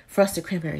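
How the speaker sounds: ordinary talk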